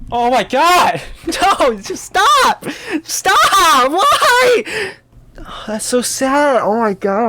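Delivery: very high, loud squeals with laughter, slowing at the end; laughing anguished squeal. Real, not acted. Streamer squealing at a sad scene, very high, loud and laughing. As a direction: squeal in mock despair, laughing through it.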